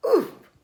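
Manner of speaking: loud high oof on impact; pig landing grunt. A narrator's reading. Pig lands with a thump, loud grunt. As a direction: grunt as you hit the ground. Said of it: "he grunted".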